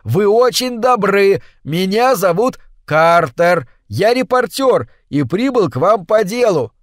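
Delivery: high, slow shouted words; shouting. Acted. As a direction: yell to be heard over the noise. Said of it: "выкрикнул Картер". (громкий крик)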